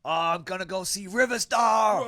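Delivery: high, loud hammed-up metal fan; mock metalhead exchange, building to a shout; screamo fan. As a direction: shout the band name like a screamo gig-goer. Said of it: "could also be screamo".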